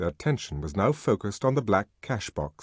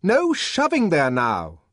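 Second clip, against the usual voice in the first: higher, louder, slow angry bark; furious shout; 8 semitones higher, 5 dB louder, slower than usual. A narrator's reading. Deputy sub-bursar shouting at the crowd in fury. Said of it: "shouted the deputy sub-bursar in a fury".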